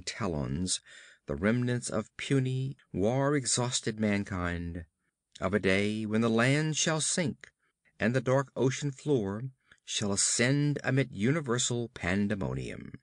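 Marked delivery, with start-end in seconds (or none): none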